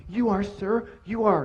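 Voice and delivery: hint of a meek underling answering the boss; submissive monkey. Monkey placates the lion.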